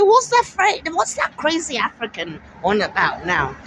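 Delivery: high mock sneering voice, fading; mocking scoffer. Vlogger imitates neighbours scoffing at her prediction, lightly mocking. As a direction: scoff like the neighbours who doubted. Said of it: "I said".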